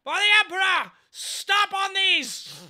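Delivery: high shouted war cry; rally the troops, screaming; battle cry. Acted.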